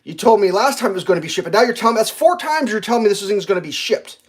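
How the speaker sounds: ordinary delivery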